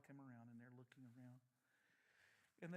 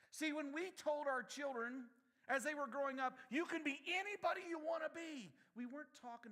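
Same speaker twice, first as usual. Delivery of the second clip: fired-up preacher; preaching; 12 semitones higher, 11 dB louder than usual. Acted. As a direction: preach it hard to the congregation. Preaching at full force.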